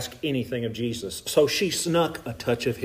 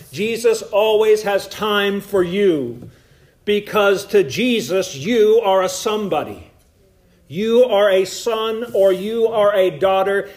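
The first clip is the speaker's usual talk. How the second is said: louder, slower emphatic preaching; full preaching; 6 semitones higher, 4 dB louder, slower than usual. Acted. Preacher reassuring the congregation, louder slow preaching. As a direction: drive it home from the pulpit.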